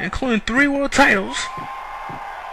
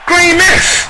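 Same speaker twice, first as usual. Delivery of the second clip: higher, louder excited call; excited shout; 6 semitones higher, 6 dB louder than usual. Real, not acted. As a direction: yell out the move in excitement. Commentator calls the green mist.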